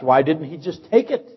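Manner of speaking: ordinary talk, nothing marked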